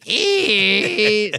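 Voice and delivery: put-on high creaking squeal of old knees; creaky squeal. Climbing stairs at the concert, a high creaky squeal. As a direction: squeak like a rusty hinge.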